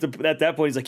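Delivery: ordinary talk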